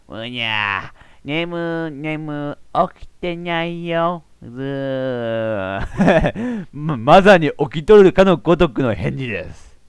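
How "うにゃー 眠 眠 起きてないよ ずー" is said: soft, slow drowsy voice; sleepy girl. Acted. Ami pretending to be asleep, drowsy mumble.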